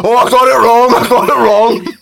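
very loud, high wail; mock anguish. Dad realising he got the baby's age wrong, loud mock anguish. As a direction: cry it out high and loud, slowing on the repeat.

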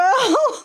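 squealing laugh, breaking up; high burst of laughter. Real, not acted. Podcaster cracks up after singing the carol verse, squealing laugh. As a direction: laugh high at your own joke.

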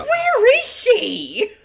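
laughing. Real, not acted. Laughing at movie.

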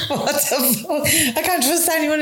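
high, laughing "what the" drawn out slow; mock shocked disbelief. Retelling her reaction to the boyfriend's false name, laughing disbelief.

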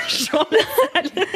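high, slow laughing name. Real, not acted. (en éclatant de rire)

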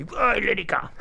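high-pitched heckler's call; heckling old lady. Retold or imitated. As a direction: mimic an old lady calling out. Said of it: "Little old lady, she gave me".

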